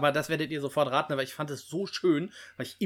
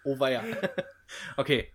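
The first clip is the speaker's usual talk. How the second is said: ordinary talk, nothing marked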